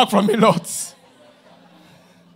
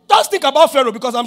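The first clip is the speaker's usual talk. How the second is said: normal talking voice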